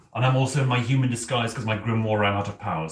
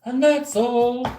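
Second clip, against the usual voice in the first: mock ad voice; 9 semitones higher, slower than usual. Quoting Spatula City ad jingle.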